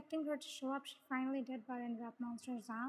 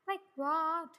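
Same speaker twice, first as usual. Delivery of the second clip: loud, irritated retort; annoyed; 3 semitones higher, 8 dB louder than usual. A narrator's reading. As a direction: throw it back, fed up. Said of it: "He sounded annoyed".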